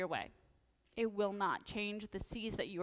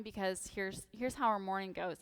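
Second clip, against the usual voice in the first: ordinary talk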